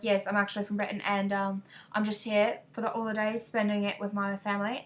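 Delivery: put-on British accent. Fooling a stranger about being from Britain, British accent. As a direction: pretend to be British in a chatty voice. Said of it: "start talking like British".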